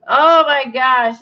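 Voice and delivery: ordinary talk, nothing marked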